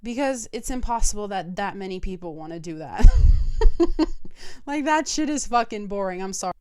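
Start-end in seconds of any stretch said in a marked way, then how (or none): 3.1-4.6 a burst of loud, airy laughter. Real, not acted. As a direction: laugh out loud at your own joke. Laughs at her own rant.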